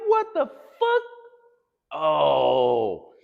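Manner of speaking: loud, high-pitched exasperated yell; retold outraged outburst. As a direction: re-enact appalled disbelief at full volume. Retold outraged reaction to the scene.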